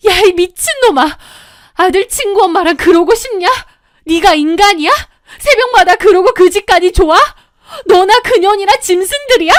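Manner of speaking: high, loud screaming tirade; wailing in fury. Acted. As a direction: scream at the cheating husband, anguished and enraged. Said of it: "저는 울부짖었고". (울부짖으며 퍼붓는 분노의 고함)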